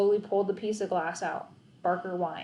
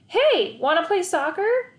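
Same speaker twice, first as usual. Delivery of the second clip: high, loud friendly call; 10 semitones higher, 6 dB louder than usual. A narrator's reading. Teammate calling to the boy from down the block. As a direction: hail him from down the block.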